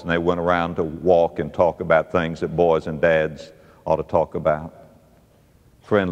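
low, soft, intimate preaching; hushed, heartfelt assurance; tender. Real, not acted. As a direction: speak gently and low, as to a friend. Tender close of the story.

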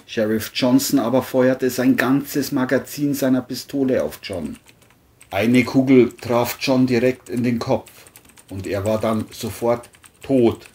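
ordinary delivery

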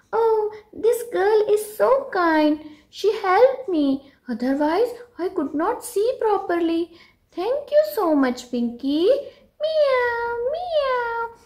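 high, sweet little cat voice; grateful kitten voice. Acted. The cat thanking kind Pinky, high sweet kitten voice. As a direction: thank her like a happy kitten.

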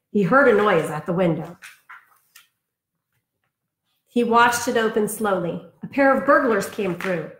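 lively storybook read-aloud; reading to children. Acted. Teacher reading a picture book to her class, in a lively storybook voice. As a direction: read it like bedtime story time for kids.

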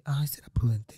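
whispered scheming aside to herself; whispered thought. Retold or imitated. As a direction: whisper it like a plotting secret. Inner thought while robbing man.

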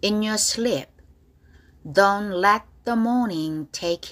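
slow, drawn-out reading of song lines; slow, deliberate model reading for students; slow lyric drill. Shown as a demonstration. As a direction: stretch each lyric line out slowly.

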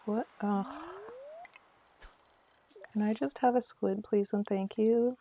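normal talking voice

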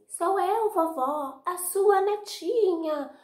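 a wolf squeaking like a sweet granddaughter; high sugary fake girl voice; wolf faking girl. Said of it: "ele disfarçou a voz, fingiu que era Chapeuzinho".

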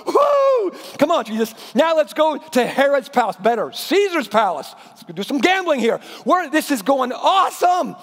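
high, loud whoop then eager urging; overjoyed cheering. Acted. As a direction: hype Jesus up like a fan. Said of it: "It's like".

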